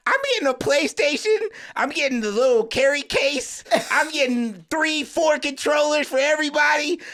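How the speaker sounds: high, loud and laughing with glee; giddy squeal of a kid's big plans; excited kid. Retold or imitated. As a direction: gush like a kid about to get rich. Excited kid dreaming of PlayStation.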